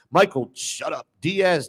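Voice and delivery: ordinary delivery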